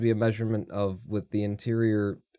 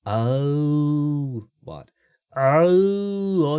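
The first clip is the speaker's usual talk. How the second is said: usual speaking voice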